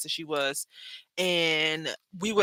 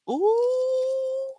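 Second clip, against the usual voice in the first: delighted ooh. Real, not acted. Delighted reaction.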